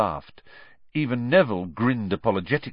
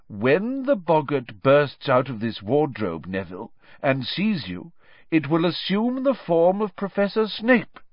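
deliberate teacher voice; Professor Lupin instructing; 7 semitones higher than usual. Acted. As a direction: set out the plan for the pupil.

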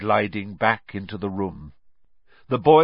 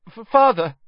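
high, loud stammered plea; frightened stammer; 12 semitones higher, 6 dB louder than usual. A narrator's reading. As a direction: stumble into a desperate appeal. Accused son pleading with his father.

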